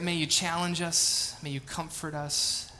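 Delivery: ordinary talk, nothing marked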